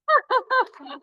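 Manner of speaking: loud high burst of laughter, delighted. Real, not acted. Host greeting a volunteer onstage, loud delighted laugh.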